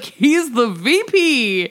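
drawn-out, incredulous proclamation; mock grand announcement. Mocking execs who introduce Billy, grand announcement. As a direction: stretch it out like a ridiculous introduction.

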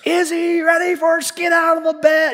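high, loud exasperated yell; mock complaining shout. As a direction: whine loudly at the early hour. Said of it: "the times that I've gotten up".

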